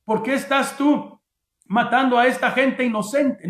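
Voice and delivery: preacher acting out an insistent accusation; hypothetical heavenly voice. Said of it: "Ni tampoco dijo la voz".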